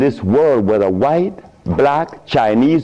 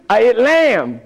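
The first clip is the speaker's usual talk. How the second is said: normal talking voice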